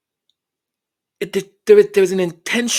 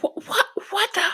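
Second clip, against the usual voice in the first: much higher, stunned mock gasp; retold shocked stammer; disbelief; faster than usual. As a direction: jump way up in pitch in disbelief. Reacting to rude colleagues.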